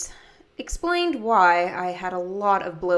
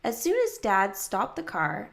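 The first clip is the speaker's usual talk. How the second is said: usual speaking voice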